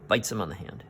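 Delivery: ordinary delivery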